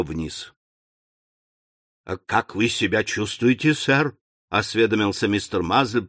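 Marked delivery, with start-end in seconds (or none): 2.0-4.2 footman's voice. Acted. Footman greeting Sam.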